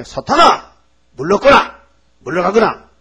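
high, loud command that eases off; commanding shout. Acted. Jesus ordering Satan away, a loud commanding shout. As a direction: drive Satan away with your voice.

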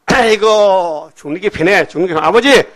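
hammed-up Jonah begging to be taken; mock fussing complaint. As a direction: moan long, then cry out in protest. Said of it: "막 야단치는 거예요".

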